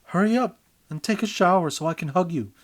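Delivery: loud, high, eager yell. A narrator's reading. Urging partner to shower so they can hug, loud yell. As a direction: shout it out, impatient to hug. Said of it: "you yell at your partner".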